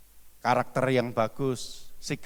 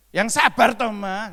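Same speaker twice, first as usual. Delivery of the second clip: much higher and louder, scolding tone; raised tone; 9 semitones higher, 6 dB louder than usual. Shown as a demonstration. Pastor showing the wrong way to tell a wife to be patient, higher and louder. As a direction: snap it at the wife, voice shooting up. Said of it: "Ini hanya contoh sedikit aja".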